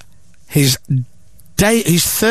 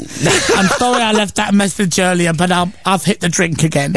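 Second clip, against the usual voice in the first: Alan Carr; 3 semitones higher than usual. Acted. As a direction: impersonate a caller sheepishly owning up to drinking. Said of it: "it's Alan".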